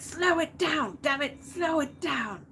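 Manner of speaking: high, urgent slow-it-down cry; mock panicked crew yelling at the captain; frantic plea. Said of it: "So then they're like".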